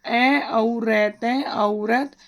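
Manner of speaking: slow, drawn-out spelling of ए औ रैत; teaching a child the sounds in औरत, slowly; sounding out letters. Shown as a demonstration. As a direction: sound out each part slowly. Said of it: "इसमें लगाएंगे औ की मात्रा".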